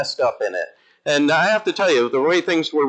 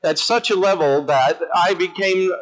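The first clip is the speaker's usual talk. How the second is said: ordinary talk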